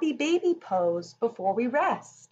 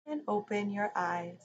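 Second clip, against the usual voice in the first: guided relaxation. Acted. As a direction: ease the children into rest. Leads rest meditation.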